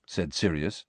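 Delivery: ordinary delivery, nothing marked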